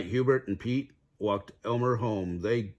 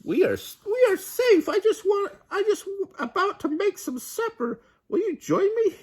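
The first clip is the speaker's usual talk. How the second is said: very high piggy voice; calm little pig; 4 dB louder than usual. A narrator's reading. Pete reassuring his brothers in a high piggy voice. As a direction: reassure them in a squeaky pig voice.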